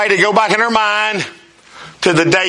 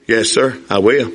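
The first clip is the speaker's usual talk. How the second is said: meek groom; 6 semitones lower than usual. Retold or imitated. Groom answering the vows.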